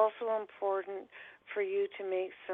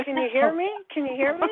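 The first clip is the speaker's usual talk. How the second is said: raised voice, checking the line; louder calling into phone; 7 semitones higher, 7 dB louder, faster than usual. Retold or imitated. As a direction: call out louder to be heard. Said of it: "And I'm going".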